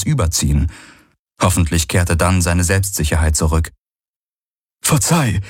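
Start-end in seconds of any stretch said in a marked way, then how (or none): 4.8-5.4 put-on humble young servant. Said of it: "sagte der junge Vierarm".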